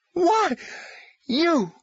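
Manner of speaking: high, loud outraged burst; sputtering fury. Acted. Caseworker loses control at Tom, loud furious sputter. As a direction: she nearly explodes at him. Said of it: "She tried to keep her voice down".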